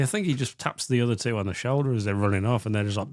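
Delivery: normal talking voice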